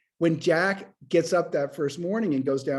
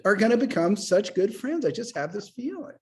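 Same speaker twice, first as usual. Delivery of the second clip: delighted; 8 semitones higher than usual. Retold or imitated. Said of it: "I was beside myself. I was like".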